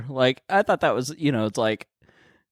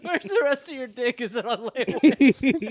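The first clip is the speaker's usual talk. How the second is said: mocking tease; 10 semitones higher than usual. Mocking Bert's small dick.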